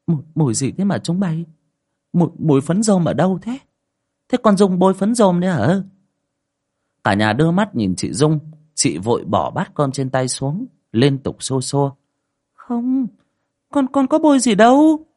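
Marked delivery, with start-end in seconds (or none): 0.1-5.8 put-on suspicious old woman asking who wore the powder; sniffing old mother. Mother at dinner sniffing, asking the family about the smell, suspicious and rising. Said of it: "Bà Nối khịt khịt mũi". 12.5-15.1 much higher, stammering scared denial; frightened denial. Acted. Daughter accused by her mother, denying in a stammering fright.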